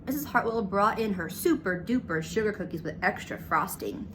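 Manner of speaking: plain reading voice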